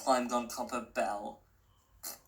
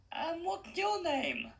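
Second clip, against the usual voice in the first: put-on much higher, unsure girl's voice; young girl Stella. A narrator's reading. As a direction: sound like the hesitant heroine.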